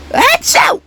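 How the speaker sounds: very high, sharp sneeze. Acted. Sick toy sneezing out another contestant. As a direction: let the sneeze explode. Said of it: "I'm sick".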